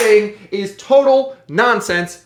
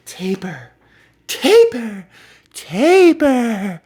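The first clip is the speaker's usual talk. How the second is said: taper, taper, taper, each one said in a different put-on tone; drawn-out mock official taper, taper, taper; mock Fed officials saying taper; 3 semitones higher, 3 dB louder, much slower than usual. Shown as a demonstration. Mocking the old Fed heads as they announce taper, in varied put-on tones. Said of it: "with what tone of voice they say it".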